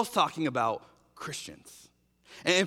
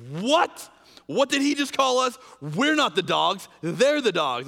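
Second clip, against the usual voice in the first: indignant shock; 4 semitones higher, 4 dB louder than usual. Retold or imitated. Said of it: "you can imagine them like".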